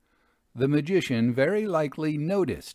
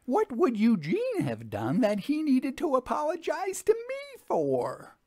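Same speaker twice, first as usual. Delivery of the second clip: much higher, outraged woman's voice; indignant widow; 13 semitones higher than usual. Acted. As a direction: stretch the last words in disbelief. The redhead widow questions them.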